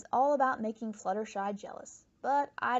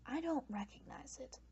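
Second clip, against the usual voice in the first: whispered aside; 10 dB quieter than usual. Real, not acted. Aside after guessing toddler show.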